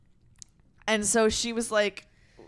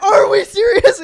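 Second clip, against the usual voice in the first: laughing disbelief; 12 semitones higher, 15 dB louder, slower than usual. Retold or imitated. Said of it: "I'm like".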